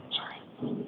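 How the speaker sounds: real choked whispered apology; emotional. Real, not acted. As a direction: barely get the word out, overcome. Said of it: "I'm a little emotional".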